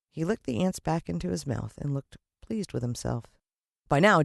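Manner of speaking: ordinary delivery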